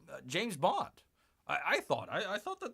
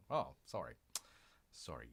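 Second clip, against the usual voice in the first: much softer, lower sheepish sorry after being shushed; hushed apology; 12 dB quieter than usual. Real, not acted. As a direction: drop right down, apologise under your breath. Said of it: "Quiet down, Bear".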